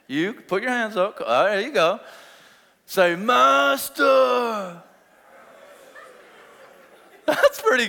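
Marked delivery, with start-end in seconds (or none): 3.2-4.8 long-held, higher leper call. Shown as a demonstration. Preacher showing the congregation the leper cry, drawn out high. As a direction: draw the word out as a leper's plea. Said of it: "this is leprosy".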